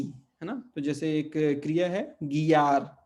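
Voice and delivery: usual speaking voice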